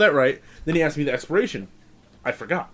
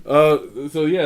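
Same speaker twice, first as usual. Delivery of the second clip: laughing; 2 semitones higher, 5 dB louder, slower than usual. Real, not acted. Recovering from laughter.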